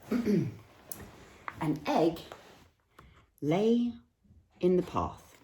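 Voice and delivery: slow storybook reading with long pauses; reading to children. Acted. Reading picture book aloud.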